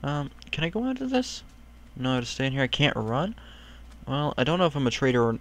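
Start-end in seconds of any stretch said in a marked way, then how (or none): none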